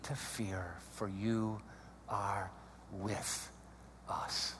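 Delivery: low, soft prayer with long pauses; reverent, fading toward a near whisper; hushed prayer. Real, not acted. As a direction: pray it slowly and quietly, phrase by phrase. Leads a closing prayer.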